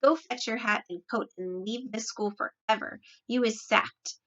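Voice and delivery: ordinary delivery, nothing marked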